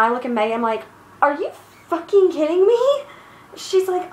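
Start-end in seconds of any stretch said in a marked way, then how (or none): 1.2-2.8 higher-pitched, acted-out disbelief; mock-outraged question, pitched up; incredulous quote. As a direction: quote yourself in rising disbelief. Retold disbelief to teammate Megan.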